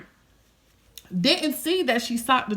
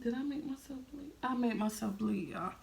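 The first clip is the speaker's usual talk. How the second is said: much softer, muttered to self; barely audible worry; 12 dB quieter than usual. Real, not acted. Checking a cut on herself, much softer murmur.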